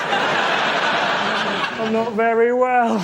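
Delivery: slow pitiful whimper. Acted. Patient telling the doctor, slow and pitiful. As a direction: stretch it out like a sorry patient. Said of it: "and it just".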